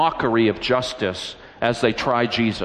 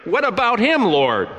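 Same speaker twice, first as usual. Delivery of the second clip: indignant question; 9 semitones higher than usual. Acted. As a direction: pitch jumps high on a protesting question. Peter jealous question to Jesus.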